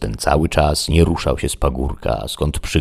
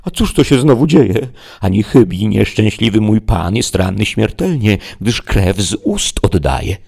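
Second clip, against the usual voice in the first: higher, louder panicked servant; alarmed squire Sancho; 6 semitones higher, 5 dB louder than usual. Acted. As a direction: fret aloud as if your master is dying. Sancho alarmed at his master.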